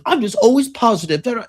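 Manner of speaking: high, sing-song mockery of a broke optimist; mocking loser. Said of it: "but you're like".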